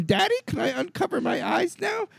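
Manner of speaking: put-on high-pitched small-child voice; little kid. Little kid asking dad during the film, high imitated child voice. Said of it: "I hear two little kids going".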